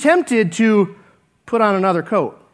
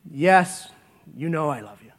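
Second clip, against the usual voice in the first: softer, lower, hurt Peter; humble quiet answer; 7 semitones lower, 7 dB quieter than usual. Retold or imitated. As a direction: answer Jesus barely above a murmur.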